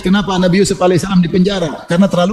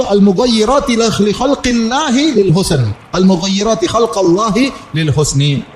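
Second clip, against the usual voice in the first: slow, measured Arabic recitation; hadith recitation; 2 semitones higher, slower than usual. Acted. Preacher quoting the Prophet to his listeners, slow recitation. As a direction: draw out the syllables as a sacred quotation.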